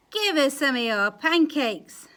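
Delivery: loud, rude demand. Acted. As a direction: shout it greedily. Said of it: "said the rotten lot".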